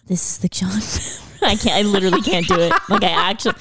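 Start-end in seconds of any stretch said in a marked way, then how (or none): 0.1-1.4 soft breathy try at the intro; whispered attempt. Shown as a demonstration. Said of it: "My voice just doesn't go there".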